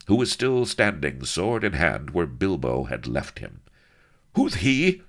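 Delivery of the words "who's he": high, loud demand; sharp cry. A narrator's reading. Don Lardo demanding of Enchilada, high loud cry. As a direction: bark the question at the stranger. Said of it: "cried Don Lardo".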